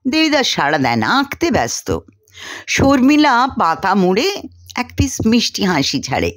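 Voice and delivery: usual speaking voice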